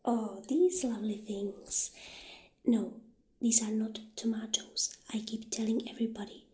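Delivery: ordinary talk, nothing marked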